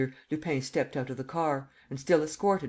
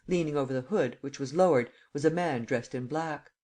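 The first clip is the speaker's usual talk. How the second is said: usual speaking voice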